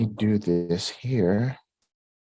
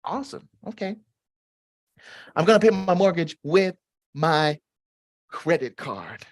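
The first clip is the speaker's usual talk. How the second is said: reading Connie's comment; 9 semitones higher than usual. Retold or imitated. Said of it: "Connie says".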